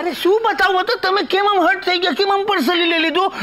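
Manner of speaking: high-pitched, aggrieved rush of excuses; high, wounded placating; flustered protest. Acted. Protests that visitor took offence.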